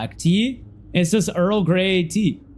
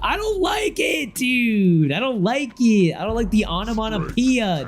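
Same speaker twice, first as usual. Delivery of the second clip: whining protest; 7 semitones higher than usual. Real, not acted. Streamer objecting to the word pop, whining high. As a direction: whine it high in exaggerated dismay.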